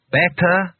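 a mildly pushy shopkeeper asking; demanding. A narrator's reading. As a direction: demand an answer. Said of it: "he demanded".

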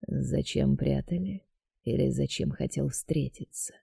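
quiet sly deity echoing her; dark god voice. A narrator's reading. God repeats her question.

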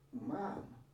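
usual speaking voice

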